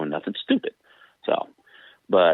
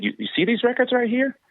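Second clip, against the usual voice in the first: high, smug coach showing proof. Retold or imitated. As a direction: mimic the coach pointing at records. Coach pulls up records.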